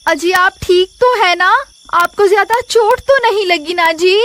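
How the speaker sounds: wife asks her husband if he is hurt, worried. Acted. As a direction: fuss over him with concern. Wife checks on injured husband.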